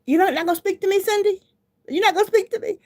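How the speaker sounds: high, loud offended lady voice; mock offended stranger. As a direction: play the hurt stranger who feels ignored. Stranger's offended high-pitched complaint.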